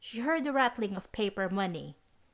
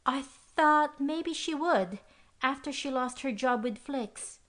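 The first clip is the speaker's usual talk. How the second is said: put-on nervous girl; 6 semitones higher than usual. A narrator's reading.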